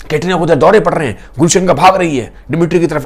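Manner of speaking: usual speaking voice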